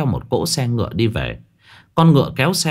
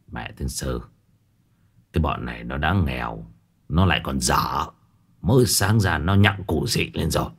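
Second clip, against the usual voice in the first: low, muttered grumble under his breath; grumbling old man; 8 semitones lower, 4 dB quieter than usual. Acted. Old master grumbles to himself, low and annoyed. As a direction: curse them quietly to yourself. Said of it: "Lão cau mày nghĩ".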